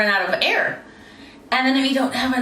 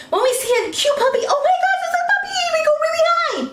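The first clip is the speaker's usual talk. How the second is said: high excited puppy voice; faster than usual. Shown as a demonstration. Coach demonstrating the high voice for a puppy. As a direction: squeal over a cute puppy. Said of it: "we have a really high voice".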